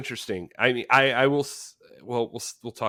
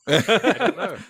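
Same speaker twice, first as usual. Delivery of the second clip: laughing; 4 semitones higher, 6 dB louder than usual. Real, not acted. Laughing at the review.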